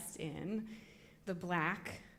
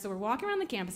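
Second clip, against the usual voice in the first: ordinary delivery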